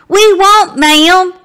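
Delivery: high, loud chirpy reply; sing-song promise of two boys; chiming together. Acted. The two boys answering Abe's mama together in a high, bright chime. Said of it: "Alston and Abe chimed together".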